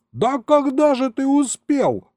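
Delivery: high, incredulous outburst; outraged yell. A narrator's reading. Grandson to grandpa about the robbery, high yelling disbelief. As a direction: cry out in disbelief, pitch way up. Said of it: "заорал я".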